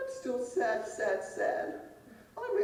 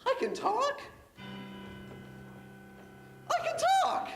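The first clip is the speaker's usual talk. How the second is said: high, delighted exclaim; joyful discovery; 10 semitones higher than usual. Acted. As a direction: marvel that words come out. Pig discovers speech.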